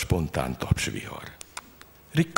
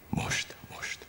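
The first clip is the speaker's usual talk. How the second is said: fojtott, zöngétlen suttogás; súgd el visszafojtott lélegzettel; feszült suttogás; 8 dB quieter than usual. A narrator's reading. Feszült várakozás a felugrás előtt. (suttogva, feszülten)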